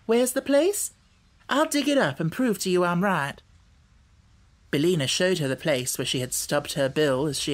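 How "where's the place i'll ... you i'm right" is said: put-on high-pitched girl, eager to prove it; higher, insistent girl's voice; Dorothy, determined girl. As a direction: challenge as a determined child.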